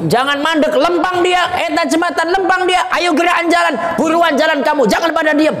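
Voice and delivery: very high, urgent acted shouting; angel snapping orders. Angel hurries people along the bridge, acted high-pitched barking. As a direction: bark orders to keep them moving. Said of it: "malaikatnya bentak".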